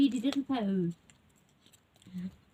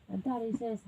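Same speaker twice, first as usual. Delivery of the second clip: ordinary delivery, nothing marked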